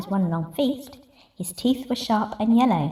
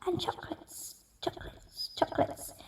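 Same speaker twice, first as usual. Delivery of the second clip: whispered fading echo; echo from cave; 7 semitones higher, 14 dB quieter than usual. Acted. Cave echoing back the rat's question in a whisper. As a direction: let it die away like an echo. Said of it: "the voice from the cave replied".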